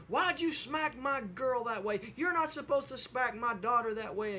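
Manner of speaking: mildly acted angry mother; griping mother. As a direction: tell off the kid who hit your girl. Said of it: "she starts griping at Susie".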